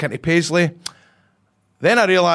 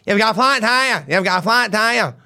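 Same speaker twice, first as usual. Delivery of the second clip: higher robotic warning voice; car warning voice; 6 semitones higher than usual. Retold or imitated. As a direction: read it like a dashboard alert. Quoting the car's warning.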